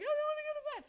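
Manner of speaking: put-on high, loud toddler yell; screaming toddler. Mom imitating her son's high loud refusal. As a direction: shout it like a defiant two-year-old. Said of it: "he was like".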